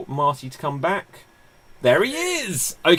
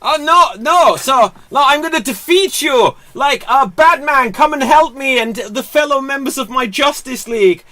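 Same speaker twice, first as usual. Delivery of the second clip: put-on high, loud heroic Wonder Woman with a shaky Israeli accent; bad Israeli accent; 10 semitones higher, 10 dB louder than usual. Wonder Woman calling Batman, poor Israeli accent. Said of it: "my best Israeli accent and it's not good".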